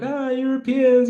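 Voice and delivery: high, drawn-out mimicked whine; mock complaint. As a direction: pitch it up in pretend accusation. Mimicking Africans blaming Europeans.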